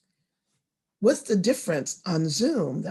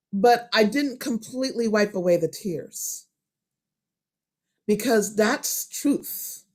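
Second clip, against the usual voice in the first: ordinary delivery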